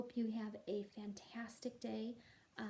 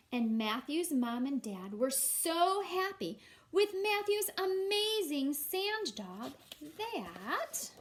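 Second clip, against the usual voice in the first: slow, louder, drawn-out happy ending; storybook narration; 4 semitones higher, 6 dB louder, slower than usual. A narrator's reading. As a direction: stretch the happy ending for the kids.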